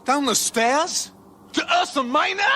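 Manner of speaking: can't believe what he just heard; incredulous. Acted. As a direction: Zaphod doubting the impossible journey. Incredulous at the claim.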